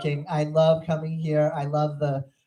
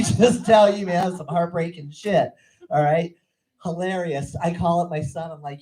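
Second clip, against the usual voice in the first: ordinary talk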